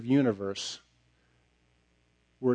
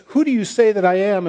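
higher and louder, calling out the question; ringing question; 8 semitones higher, 9 dB louder than usual. Acted. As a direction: make the question ring out. Jesus's question voiced by preacher.

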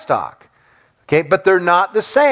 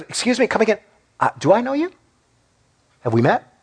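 puzzled non-recognition; 4 semitones higher, 3 dB quieter, much faster than usual. Acted. Jesus to a self-identified follower, mock puzzled. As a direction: act as if faced with a total stranger.

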